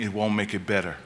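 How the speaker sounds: ordinary talk, nothing marked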